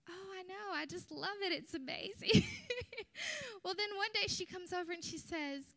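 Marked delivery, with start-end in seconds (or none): 0.1-2.4 rising high and loud into a squeal; gushing delight. Retold or imitated. Preacher retelling her own gushing reply, excited. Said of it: "And I would say". 2.5-3.6 a high burst of laughter. Real, not acted. Preacher laughing at her own confession, high laugh.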